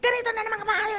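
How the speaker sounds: high, put-upon grumble about the bill; complaining customer. Acted. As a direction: whine at the bill collector like a fed-up customer. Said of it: "Silang pinagbubuntangan".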